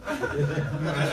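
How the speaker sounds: ordinary delivery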